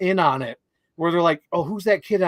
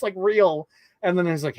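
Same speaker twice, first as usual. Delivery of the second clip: usual speaking voice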